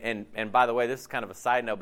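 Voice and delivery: usual speaking voice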